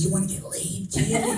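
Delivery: lightly voiced old woman, soft then squeaking up; a hint of a frisky old lady; a lightly done old customer leering at the plumber; old woman propositioning. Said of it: "She's like".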